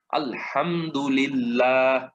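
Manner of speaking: slow আলহামদুলিল্লাহ with a light lam after i; thin lam. Shown as a demonstration. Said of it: "পাতলা করে পড়ছি".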